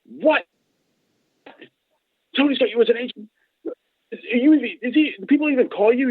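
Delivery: high, disbelieving hyper questions; hyper disbelief. Retold or imitated. Dave amazed Mike is Tony's agent, high hyper disbelief. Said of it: "Dave's real hyper".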